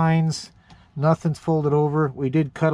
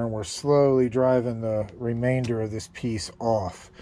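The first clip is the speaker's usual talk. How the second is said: normal talking voice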